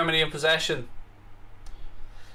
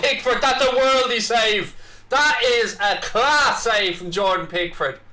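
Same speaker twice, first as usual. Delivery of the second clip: excited match commentator, pitch raised; live play-by-play; 5 semitones higher than usual. Real, not acted. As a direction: call the attack, then erupt at the save.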